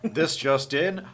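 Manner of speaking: chipper 1950s ad. Acted. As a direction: sell it like an old radio ad. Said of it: "[name] says".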